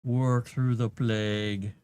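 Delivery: drawn out, tasting the words; slow repeat. Shown as a demonstration. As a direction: slowly savour the phrase. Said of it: "I'm glad you're able".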